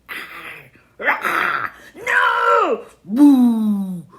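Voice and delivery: loud mock wail of frustration; exaggerated frustrated cry and boo; hammed-up tantrum. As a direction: throw a playful tantrum. Tantrum of emotions after losing.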